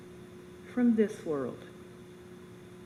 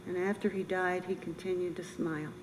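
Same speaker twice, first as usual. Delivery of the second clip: normal talking voice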